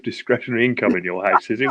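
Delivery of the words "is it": ordinary talk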